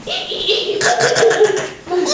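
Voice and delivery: very high breathy monkey screech; monkey call. Shown as a demonstration. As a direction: squeal it like a playful monkey. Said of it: "And a monkey says".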